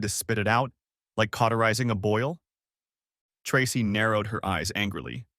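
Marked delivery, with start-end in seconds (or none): none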